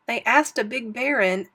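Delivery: plain reading voice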